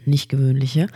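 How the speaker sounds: usual speaking voice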